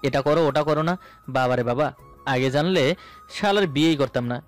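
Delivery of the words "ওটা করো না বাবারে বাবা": normal talking voice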